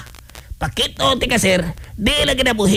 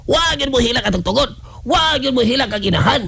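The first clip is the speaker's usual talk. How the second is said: ordinary talk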